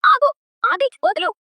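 high, desperate cry to stop; panicked plea. Acted. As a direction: scream at him to let go in panic. Sister begs brother to stop fighting. (ఆది ఆగమని భయంతో ఎత్తు స్వరంలో అరవడం)

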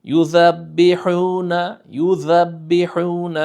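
يُذَبِّحُونَ slow, with a clear zal and doubled ba; tajweed pronunciation. Shown as a demonstration. Teacher showing the zal in يُذَبِّحُونَ. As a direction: stretch يُذَبِّحُونَ, voicing the zal. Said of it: "জাল দিয়ে আযাবি".

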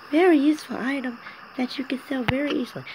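character voice. Acted. Character voice, high.

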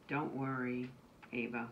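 usual speaking voice

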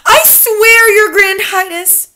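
high, loud desperate oath; fearful. A narrator's reading. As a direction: plead in fear to the leader. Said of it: "She began to shake with fear".

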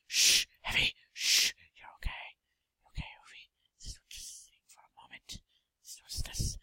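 hushed whisper to a patient; soft bedside voice. Pretend. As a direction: whisper gently like a calm doctor. Soothing patient before cutting.

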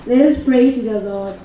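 ordinary delivery

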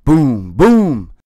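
loud, heavy booms; thundering dinosaur steps; booming footsteps. Acted. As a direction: stomp it out like giant footsteps.

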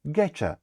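"getcha" with a blended CH sound; casual "getcha" reduced. Shown as a demonstration. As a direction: run get and you together into getcha. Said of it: "дуже часто ви можете почути".

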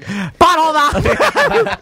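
put-on high, slow, drawn-out kid holler; stretched-out high mock yell; gleeful kid blurt. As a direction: imitate boys blurting the rude word. Retold boys blurting it at grandparents.

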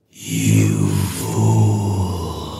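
very low, slow, inhuman boom; deep, hollow, unearthly voice. Acted. Unknown thing answering from the sepulchre, deep hollow voice. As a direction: remote disembodied voice, fading into breath. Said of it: "the voice was deep, hollow, gelatinous, remote".

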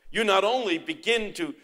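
ordinary talk, nothing marked

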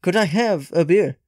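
imitating a friendly Swedish singer asking for a beer; Swedish accent. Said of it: "in this nice Swedish accent".

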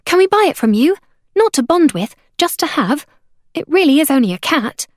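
put-on high, loud, eager girl; impulsive outburst. A narrator's reading. Girl begging to buy the caged cat, bursting out. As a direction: burst out pleading. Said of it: "Abeka burst out".

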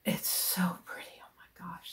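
hushed, breathless delight; awed whisper. Real, not acted. Thanking Jude for yarn, hushed whisper. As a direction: whisper like it's too pretty to speak.

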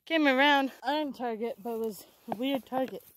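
normal talking voice

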